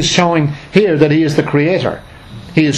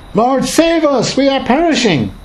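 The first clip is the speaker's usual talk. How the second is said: high, slow desperate cry for help; panicked plea; 10 semitones higher than usual. Acted. Terrified disciples crying out to Jesus in the storm, high and desperate. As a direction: beg to be saved from drowning.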